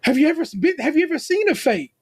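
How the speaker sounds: high, mock British host voice; a light imitation of a British accent asking a question; imitating Allison's accent. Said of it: "Allison walk up to her and is like".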